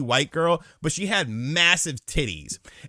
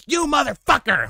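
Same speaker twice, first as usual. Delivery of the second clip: put-on high, louder furious girlfriend; mock angry girlfriend; 14 semitones higher, 4 dB louder than usual.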